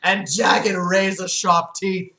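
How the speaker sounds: slow, emphatic horror reading; dramatic horror narration. A narrator's reading. Narrator unveiling Lucifer to listeners, slow dramatic horror reading. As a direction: draw out each gruesome detail.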